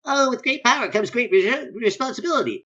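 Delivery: high, earnest hero voice; Spider-Man. Acted. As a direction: preach it like a goody superhero. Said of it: "Spider-Man's here is going".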